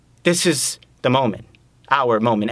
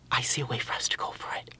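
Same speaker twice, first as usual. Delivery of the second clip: soft, confiding, much quieter; hushed proposal; 11 dB quieter, faster than usual. Acted. Man offers partner a way forward, hushed. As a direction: drop to a near whisper.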